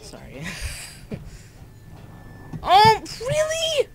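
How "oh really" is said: very high, loud, drawn-out question; exasperated disbelief. Real, not acted. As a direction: squeal it in disbelief. Exasperated by game setback.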